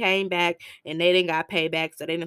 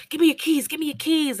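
higher and louder, hurried; urgent demand; 9 semitones higher, 4 dB louder, faster than usual. Retold or imitated. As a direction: grab for the keys fast.

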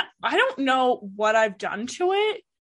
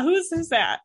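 ordinary talk, nothing marked

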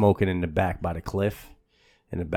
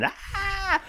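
a mock scream, much higher; quick high imitated shriek; 6 dB quieter than usual.

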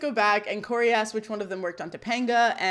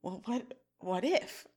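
soft, halting imitation of dawning doubt; moment of crisis; 10 dB quieter, slower than usual. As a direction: act out a hushed, hesitant realization. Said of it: "he had a little moment of crisis".

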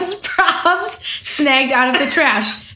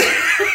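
high laugh. Real, not acted. Laughing at bonkers neighbor.

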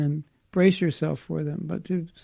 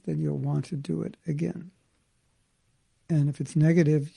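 ordinary delivery

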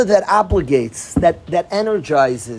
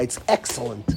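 ordinary delivery

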